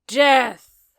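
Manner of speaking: loud, high edgy cry; dramatic exclamation. Acted. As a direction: proclaim it darkly. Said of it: "then exclaimed".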